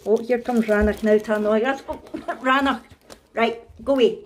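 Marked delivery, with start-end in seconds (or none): none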